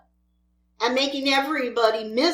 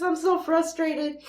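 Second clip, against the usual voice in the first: frustrated; 4 semitones higher than usual. Real, not acted.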